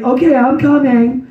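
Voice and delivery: calling back. Retold or imitated. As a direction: answer a child from downstairs. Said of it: "I'd be like".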